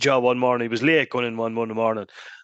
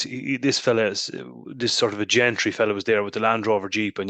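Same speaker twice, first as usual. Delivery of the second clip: ordinary delivery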